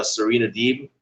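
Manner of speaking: ordinary talk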